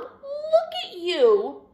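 high, loud exclamation; dismayed parent. A narrator's reading. Parents greeting bedraggled Douglas at home, high surprised voice.

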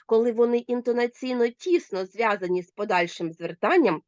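ordinary delivery

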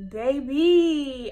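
ordinary talk, nothing marked

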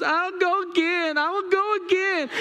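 put-on high, insistent child's voice; eager little boy. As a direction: imitate a kid demanding another ride. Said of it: "And he goes".

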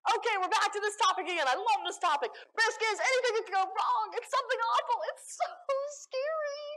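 high, loud mock panic building to a cry; hammed-up panic. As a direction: rant like everything is on fire. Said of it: "I communicate almost exclusively through panic attacks".

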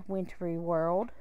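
plain reading voice

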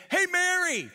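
high, loud call down a street. Pretend. Calling Mary on an ancient Israel street, loud and high. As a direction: call her name across the street. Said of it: "and said".

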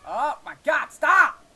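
high, loud outburst; real frustration boiling over; exasperated yell. Real, not acted. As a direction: shout it, rising to a yell. Yells at the lagging game.

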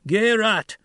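high, loud one-word call; shouted command. A narrator's reading. Paul ordering the steersman to release, shouted. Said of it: "Paul shouted".

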